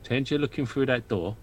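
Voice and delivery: a spooked dwarf whispering-low to his friend; low hushed dwarf Leopold. Acted. Player voicing Leopold character.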